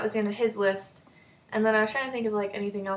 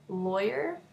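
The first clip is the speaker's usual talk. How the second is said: ordinary talk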